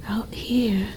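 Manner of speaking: soft, hushed whisper; hushed aside to herself. Acted. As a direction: breathe it over the frozen body. Creature finds a freezing human.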